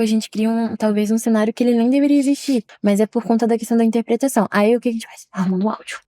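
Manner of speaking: ordinary delivery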